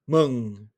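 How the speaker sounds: meng low, throaty vowel; Vietnamese mừng. Shown as a demonstration. Teacher drills Vietnamese syllable for class, low throaty tone. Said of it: "quel son entendez-vous?".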